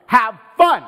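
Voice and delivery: loud, bossy command to have fun; mock king's order. King ordering his murdered masters' servants to have fun, loud and bossy. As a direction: bark it like a tyrant king throwing a party.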